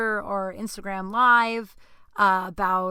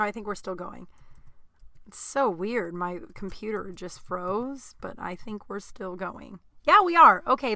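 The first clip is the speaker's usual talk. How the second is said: normal talking voice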